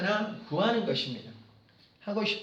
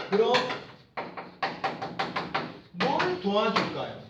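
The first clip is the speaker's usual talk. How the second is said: positive, helpful; 4 dB quieter than usual. Acted. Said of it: "너무나 긍정적이잖아요".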